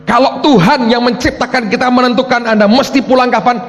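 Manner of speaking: high, driving pulpit delivery; forceful preaching. Real, not acted. Preacher challenging the congregation about God's timing, forceful preaching.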